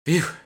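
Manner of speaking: high, loud breathy phew; hammed-up sigh of relief; exaggerated relieved phew. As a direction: blow out fake relief. Relief after faked vomiting.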